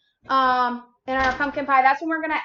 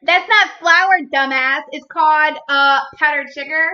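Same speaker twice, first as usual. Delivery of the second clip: louder mock-outraged correction; loud scolding; 9 dB louder than usual. Real, not acted. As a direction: snap at him playfully. Scolding husband over flour.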